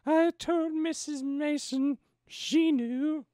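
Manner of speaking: high, slow put-on lady's voice; high, halting woman's voice reading aloud; mother's reply. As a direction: raise it high and draw it out, ladylike. Plays the mother in the story.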